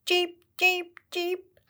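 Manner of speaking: high chirping peeps like little birds; sparrow chirps. Acted. Sparrows answer the rabbit.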